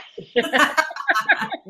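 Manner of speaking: a high giggle at her own bold invitation; high laugh. Real, not acted. Giggling at telling viewers to feel themselves up. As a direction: laugh it off, pitch rising.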